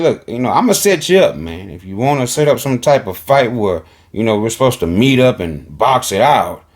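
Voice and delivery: fast, run-together retelling; retold quote. Host quickly retelling what Charleston said about a fight. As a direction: rattle off his words at x1.7. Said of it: "you hear Charleston saying".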